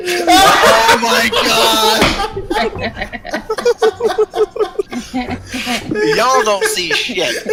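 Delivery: high, loud helpless laughter through the words; can barely get the words out for laughing; laughing hard. Real, not acted. GM breaking up at his players, laughing hard. GM cracking up at table.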